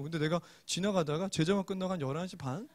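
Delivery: normal talking voice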